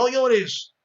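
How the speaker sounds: put-on high, loud stern scolding of a crying boy; a parent snapping at a crying son to stop. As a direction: tell the boy off like a strict father. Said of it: "¿Qué le decimos a los niños cuando están llorando?".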